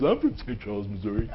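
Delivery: mock indignation, kept quiet; indignant. Audience member objecting to the speaker's joke, indignant. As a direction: softly imitate an affronted local. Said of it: "stood up real indignant".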